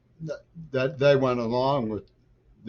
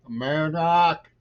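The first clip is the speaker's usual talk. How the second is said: higher mocking repeat; sarcastic; 6 semitones higher, 5 dB louder, slower than usual. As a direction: scoff it back as a joke. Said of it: "You know, sarcastically".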